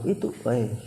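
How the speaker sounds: normal talking voice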